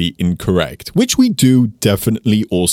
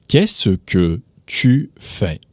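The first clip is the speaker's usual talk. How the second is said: slow, each word set apart; "Qu'est-ce que tu fais" spaced out word by word; slow demo; much slower than usual. Shown as a demonstration. Teacher showing learners the full French question, slowly and carefully. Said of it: "make that a little bit easier".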